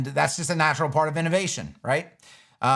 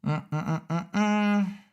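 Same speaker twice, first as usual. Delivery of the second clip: short hummed notes, last one held higher; idle humming; 4 semitones higher, slower than usual. Real, not acted. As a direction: hum absently to yourself. Idle humming while finding next question.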